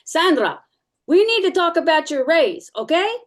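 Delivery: high, loud, breezy boss voice; boss in the hallway. Retold or imitated. Boss calling into her doorway about her raise. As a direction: toss it off casually while walking past.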